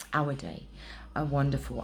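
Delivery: normal talking voice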